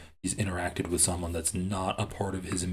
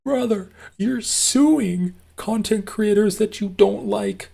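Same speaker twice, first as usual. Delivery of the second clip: much higher, louder and slow, a sarcastic put-on voice; mocking voice; 11 semitones higher, 6 dB louder than usual. Streamer voicing a sarcastic retort to a rival, mocking. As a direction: drag it out in a whiny mock tone.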